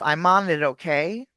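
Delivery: "I'm on it, okay" joined up as "I-mo-ni-tokay"; linked in one breath. Shown as a demonstration. Coach demonstrates one breath, "I'm on it, okay" all linked. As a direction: run "I'm on it, okay" through without any break. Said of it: "all in one breath".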